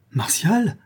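high, shocked question; alarmed cry. A narrator's reading. La Louve seizes the fisherman by the collar, alarmed. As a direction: cry his name in alarm. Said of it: "S'écria la Louve en saisissant".

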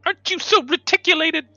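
squeaky mock voice. Teasing squeak, pitch jump.

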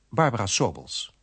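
usual speaking voice

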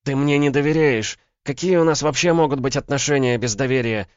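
high, loud female protest; indignant outburst. A narrator's reading. Woman accusing her lover of distrust, indignant.

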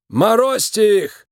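high creaky cry, drawn out; shouted order. A narrator's reading. Boss orders his polar bears to act, creaky shouted command. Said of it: "закричал он белым медведем".

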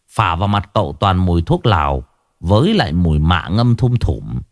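plain reading voice

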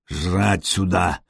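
put-on low, slow Norseman growl in accented Slavic. A narrator's reading. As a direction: growl the order low and drawn-out. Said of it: "по-словенски с акцентом прорычал Нурман".

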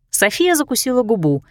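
plain reading voice

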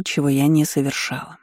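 plain reading voice

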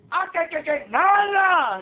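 high, drawn-out scoffing brush-off; scoffing parent who won't pray; dismissive mock parent. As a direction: drag it out like a parent waving it away.

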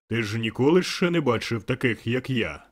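booming spirit voice; loud exclamation. A narrator's reading. The spirit exclaiming to Scrooge, booming voice. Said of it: "вигукнув дух".

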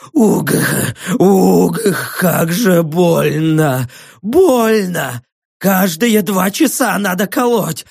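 strained, drawn-out words between groans; groaning in agony. Acted. Poisoned prisoner starts to confess, groaning in agony. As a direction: force the words out through terrible pain.

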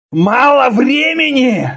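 slow, high furious shout; growled roar. A narrator's reading. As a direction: roar it back in outrage. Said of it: "зарычал Конан".

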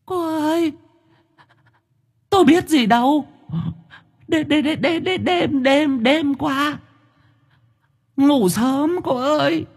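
very high, whimpering and stammering ghost voice. A narrator's reading. Ghost pleads innocence to Thơm, very high whimper. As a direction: stutter and whimper like a scared ghost. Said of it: "mụ dô lúc này mếu máo".

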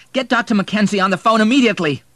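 high, loud, fast command; urgent order. A narrator's reading. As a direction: order help in an emergency.

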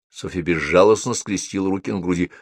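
ordinary delivery, nothing marked

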